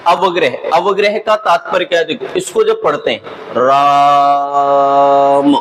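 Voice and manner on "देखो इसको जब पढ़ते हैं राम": राम sung slowly, its long vowel held far past normal length; drawn-out pluta vowel. Shown as a demonstration. Teacher demonstrating the pluta vowel to students, sung with a long drawn राम. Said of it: "थोड़े अधिक समय के लिए उच्चारित कर लिया गया".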